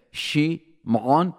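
Shimon very slow, every letter placed; careful pronunciation. Shown as a demonstration. Guest demonstrating seeing each letter as you pray. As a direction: Shimon drawn out, shin, mem, ayin, nun. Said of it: "see the shin coming from your teeth".

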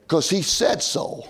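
ordinary talk, nothing marked

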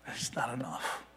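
soft, unvoiced whisper. Real, not acted. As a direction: let the line fall to a bare whisper. Sermon drops to a hush.

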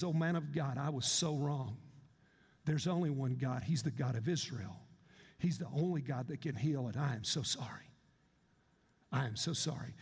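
bow your head and mean it; humble apology. Retold or imitated. Naaman bows and apologizes.

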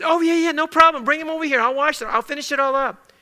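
high, breezy, obliging reply; acted eager-to-help voice; without grumbling. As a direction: take on the chore cheerfully, pitched well up. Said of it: "if you do it without it".

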